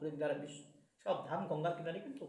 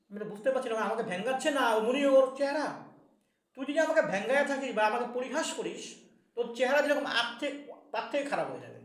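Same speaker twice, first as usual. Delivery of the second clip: stern curse; 4 semitones higher, 6 dB louder than usual. Acted. Sage curses the mocking boy.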